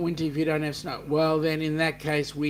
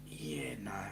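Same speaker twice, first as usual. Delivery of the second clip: unvoiced whisper, much softer, falling; whispered dismissal; 3 semitones lower, 11 dB quieter than usual. Real, not acted. Host scoffs at Foster's to the chat, whispered scoff. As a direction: brush it off under your breath.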